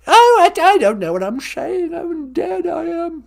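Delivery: put-on high, flustered comic old royal; hammed-up British granny losing her thread; put-on high-pitched monarch voice; Queen Mum. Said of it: "It's the Queen Mum".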